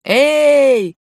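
high, loud drawn-out hail; long loud call; shout. A narrator's reading. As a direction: shout to catch someone's attention. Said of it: "крикнул Андрюша".

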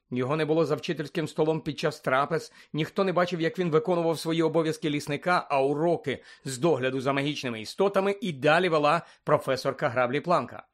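ordinary delivery, nothing marked

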